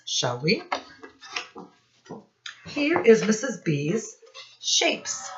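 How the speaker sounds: normal talking voice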